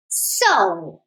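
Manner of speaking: low rumbling lion voice; deep booming lion roar. Acted. Lion roaring at the mouse who woke him, low voice. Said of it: "the lion roared".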